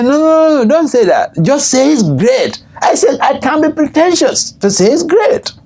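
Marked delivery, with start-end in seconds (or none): 0.0-2.6 high-pitched, insistent mock correction; acted hasty objection. Young God brother correcting the Maharaj, high insistent protest. 3.2-5.5 very high, amused mock refusal; acted protest. Maharaj refusing to pretend he is great, high protest.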